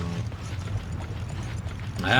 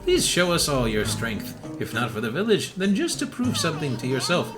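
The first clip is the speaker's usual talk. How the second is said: lightly acted, higher-pitched granny quest-giver; 6 semitones higher than usual. Granny begging a hunter to deal with the Tigrex, granny voice. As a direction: plead for help as a village granny. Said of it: "the good Granny voice".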